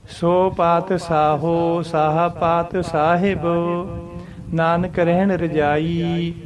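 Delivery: slow, chant-like reading of the verse; slow devotional recitation. Shown as a demonstration. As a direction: intone the verse slowly. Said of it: "ਪਾਤ ਸਾਹਿਬ ਦੇ ਉੱਤੇ ਵਿਸ਼ਰਾਮ ਆਊਗਾ". (ਹੌਲੀ ਹੌਲੀ ਗੁਰਬਾਣੀ ਪਾਠ)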